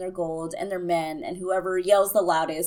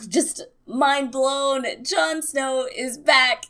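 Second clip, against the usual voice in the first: slow, higher, breathy-whispered thrill; awed excitement; 5 semitones higher, 3 dB louder, much slower than usual. Real, not acted. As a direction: let the awe spill out slowly. Climax of the reveal.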